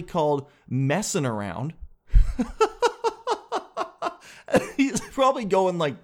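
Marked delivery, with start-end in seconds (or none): 2.1-4.1 a real, high-pitched burst of laughter. Real, not acted. Laughs at his own joke. 4.8-6.0 laughing while talking. Real, not acted. Laughing while he sets up the quote.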